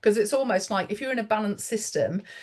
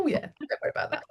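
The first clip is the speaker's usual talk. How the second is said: quick breathy whisper of reassurance; reassuring; 7 semitones higher, 4 dB quieter, faster than usual. Retold or imitated. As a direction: brush it off gently, fast and hushed. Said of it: "I'll go".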